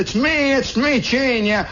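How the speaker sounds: put-on high-pitched boy calling out; put-on boyish holler; eager kid. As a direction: call through the door as an excited kid. Kid calls through the door.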